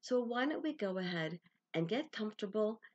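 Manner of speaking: ordinary delivery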